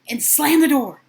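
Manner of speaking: ordinary delivery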